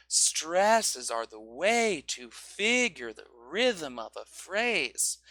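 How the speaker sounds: slow, "rhythm" lifted in pitch; pitch on stresses. Shown as a demonstration. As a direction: raise the tune on the stressed beats. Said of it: "focusing on the pitch".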